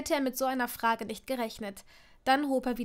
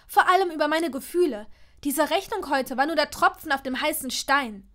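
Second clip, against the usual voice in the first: loud, raised, indignant voice; heated outburst; 5 semitones higher, 8 dB louder than usual. A narrator's reading. Hermine challenges his marriage proposal, heated and loud. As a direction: keep the angry tirade going. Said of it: "Sie war noch nicht fertig".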